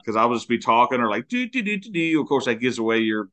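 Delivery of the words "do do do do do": light, high "doo-doo" noodling; high nonsense singsong. Retold or imitated. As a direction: babble doo-doo-doo absent-mindedly, pitched up. Imitating idle chatter on voice chat.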